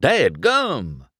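high, delighted country exclamation; eager whoop of a word; excited cry. A narrator's reading. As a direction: burst out, thrilled by the idea. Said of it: "Mater cried".

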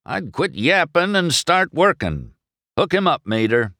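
high, emphatic retort; shooting back. A narrator's reading. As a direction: order him to get moving. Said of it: "Doc shot back".

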